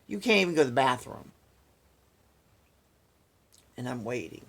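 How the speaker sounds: normal talking voice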